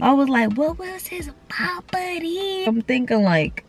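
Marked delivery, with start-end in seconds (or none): 0.5-2.7 high, soft, drawn-out wondering; puzzled self-quote. Retold or imitated. Trying to recall a song, high puzzled musing. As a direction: ask it slowly up high.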